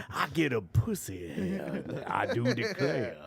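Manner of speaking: slow drawling cowboy; old cowboy voice. Retold or imitated. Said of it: "Why did you say that like an old cowboy".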